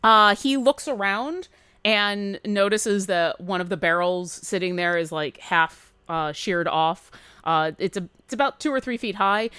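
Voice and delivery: ordinary talk, nothing marked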